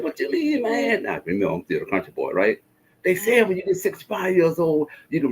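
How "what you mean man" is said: much higher, incredulous; can't believe what he hears; puzzled question. Retold or imitated. Puzzled reply to friend.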